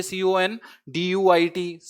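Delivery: each letter drawn out slowly; slow spelling. Shown as a demonstration. Teacher spelling conduit slowly for students to memorize. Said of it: "फिर से याद कीजिए".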